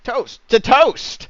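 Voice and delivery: loud, high toast cry; shouted toast. Acted. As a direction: raise the glass and yell it.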